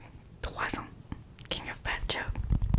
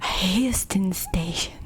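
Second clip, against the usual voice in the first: put-on mom's French accent; much slower than usual. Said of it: "so she was like".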